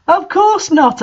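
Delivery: a light mock gasp of surprise. A narrator's reading. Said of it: "I gasped".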